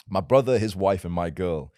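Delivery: usual speaking voice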